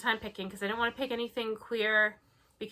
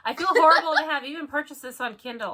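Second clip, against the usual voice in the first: mock outraged reading; 7 semitones higher, 9 dB louder than usual. Dramatic reading of review.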